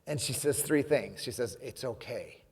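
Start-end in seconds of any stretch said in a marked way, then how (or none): 1.6-2.4 softer, slowing on the last line, kind and reassuring; gentle reassurance. Retold or imitated. Quoting kind Alice to the audience, soft and reassuring. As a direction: speak it like an old lady comforting strangers. Retelling kind stranger's words.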